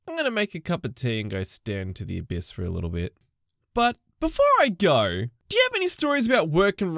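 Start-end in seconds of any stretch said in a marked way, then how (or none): 0.1-3.2 much lower, softer, drained; weary resignation. Pretend. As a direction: sink into tired defeat.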